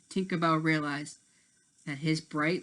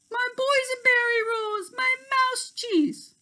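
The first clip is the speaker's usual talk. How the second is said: dismayed cry; 5 dB louder than usual. A narrator's reading. Tink finding her food bags empty, high dismayed cry. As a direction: wail over the lost snacks.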